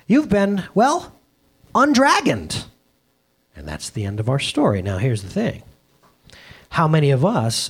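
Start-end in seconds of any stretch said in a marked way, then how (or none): 0.1-2.6 high, bright exclamation of wonder; exclaiming. A narrator's reading. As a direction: exclaim it in amazement, pitch up high.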